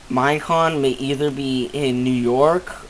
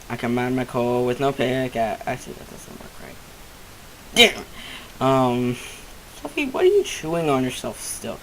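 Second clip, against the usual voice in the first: ordinary delivery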